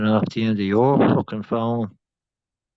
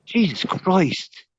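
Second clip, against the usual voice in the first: high laughing groan of Jesus Christ; drawn-out laughing disbelief; laughing exasperation; 9 semitones higher, much slower than usual. Real, not acted. Man on a call groaning at the silence, laughing.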